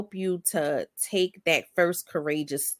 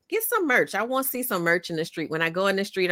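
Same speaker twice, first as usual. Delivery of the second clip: normal talking voice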